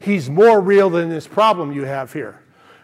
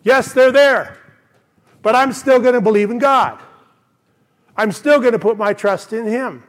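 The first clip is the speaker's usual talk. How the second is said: higher, ringing voice of stubborn trust; raised, defiant declaration of belief; defiant faith; 4 semitones higher, 4 dB louder than usual. Retold or imitated. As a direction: proclaim it high and strong as David. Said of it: "He was saying".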